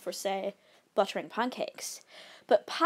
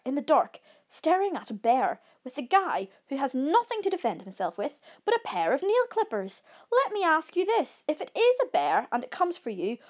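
higher, louder indignant tirade; alarmed rant; 6 semitones higher, 5 dB louder than usual. A narrator's reading. Frightened camper challenging calm friend about bear, alarmed rant. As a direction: build to an incredulous, high-pitched question.

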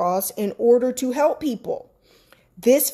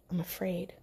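breathy whisper; whispered fear to God; whispered admission; 4 semitones lower, 14 dB quieter than usual. Retold or imitated. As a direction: whisper it like a confession. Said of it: "and I admitted it".

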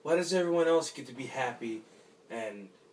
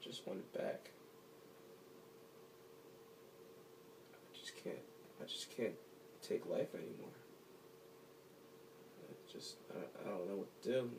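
broken whisper; 5 semitones lower, 13 dB quieter than usual. Acted. Broken man near tears, alone.

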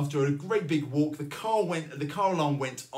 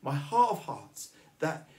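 usual speaking voice

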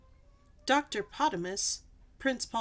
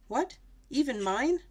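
understated, higher-pitched royal shock; mild shriek; 4 semitones higher, 4 dB louder than usual. A narrator's reading. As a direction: react with a toned-down shriek of disbelief. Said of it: "shrieked King Clifford".

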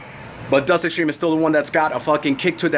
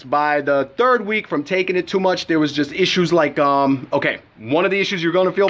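ordinary talk

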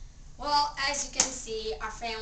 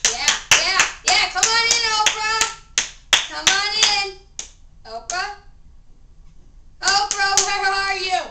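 loud, high calls to a guest offstage; eager host yelling a welcome; calling out; 7 semitones higher, 11 dB louder than usual. Pretend. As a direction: shout her name to bring her on. Calling Oprah onto the show.

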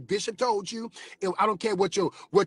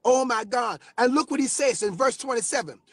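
ordinary talk

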